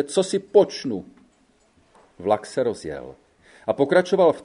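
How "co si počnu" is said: higher helpless lament. A narrator's reading. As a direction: ask it in helpless despair. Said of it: "naříkala". (naříkavě, bezradně)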